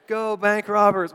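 high, loud rooting cheer; root for them out loud; acted fan cheer. Acting a viewer cheering on robbers.

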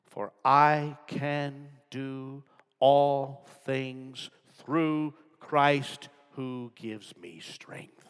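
every word drawn out slow and loud; slow proclamation. Acted. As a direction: lay each word down deliberately.

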